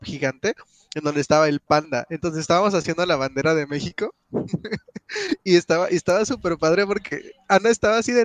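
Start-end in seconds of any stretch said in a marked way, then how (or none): 4.3-5.4 high giggle. Real, not acted. Laughs remembering the scene.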